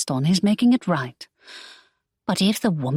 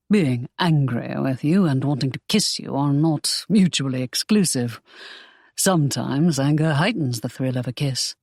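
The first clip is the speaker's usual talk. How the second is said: deep-set lower voice, knowing; Draco, low; 4 semitones lower than usual. Acted. Draco, seductive explanation.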